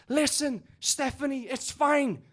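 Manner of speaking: high-pitched mock pep talk from a pal; imitated Scottish pal. Pal consoling the crying Scottish woman, imitated high and emphatic. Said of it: "her pal is trying to pick her up".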